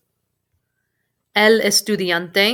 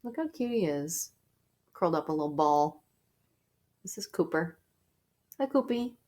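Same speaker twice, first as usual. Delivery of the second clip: cooing at dog; 9 dB quieter, faster than usual. Real, not acted. Teacher cooing at her dog.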